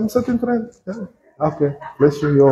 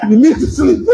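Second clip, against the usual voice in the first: ordinary talk, nothing marked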